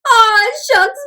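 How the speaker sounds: ordinary delivery, nothing marked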